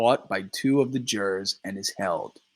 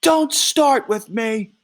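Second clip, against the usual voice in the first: slow, loud, much higher shout; 12 semitones higher, 5 dB louder, slower than usual. Acted. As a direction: yell it as a warning.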